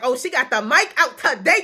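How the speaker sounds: high, loud and thrilled, cheering her on; gushing like a proud best friend; hyped-up hype girl. Retold or imitated. Reading for a friend, loud excited hype. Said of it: "I was like".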